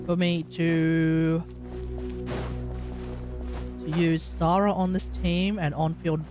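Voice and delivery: ordinary talk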